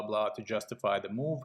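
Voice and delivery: ordinary delivery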